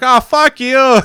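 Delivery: put-on high, loud New York accent shout; mock yell. Yelling back at a driver who slowed to yell. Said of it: "I was like".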